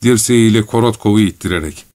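ordinary delivery

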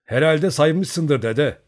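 slow, loud, drawn-out put-down; dismissive retort. A narrator's reading. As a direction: brush the old man off, loud and slow. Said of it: "başını öte yana çevirerek". (dedeye ağır ve yüksek sesle, küçümseyerek)

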